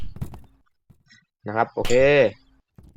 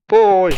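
pained yelp; 7 semitones higher, 6 dB louder, much slower than usual. Real, not acted. Caught by principal in game.

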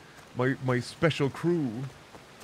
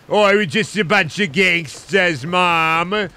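loud hammed-up cockney accent, Mom; mock cockney; 5 semitones higher, 8 dB louder, slower than usual. Streamer playing a rowdy crew to his mom, loud mock cockney accent. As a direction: shout it like a rowdy London geezer in a put-on cockney. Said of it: "Oh, fucking British, innit?".

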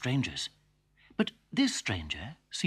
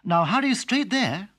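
firm mother; 9 semitones higher, 6 dB louder than usual. Acted. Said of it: "said her mother".

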